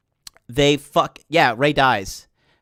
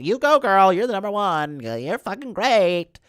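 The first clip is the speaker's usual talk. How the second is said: mock cheerleading Jedi; 5 semitones higher than usual. Jedi cheer fallen Rey on, mock hype voice. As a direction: cheer her on like a sassy friend, sarcastically. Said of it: "like, basically".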